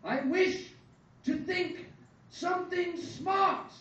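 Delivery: loud, high, slow proclamation in separate bursts; breath-emptying wish. A narrator's reading. Son makes his birthday wish, shouting it out. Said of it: "All the air had left his lungs".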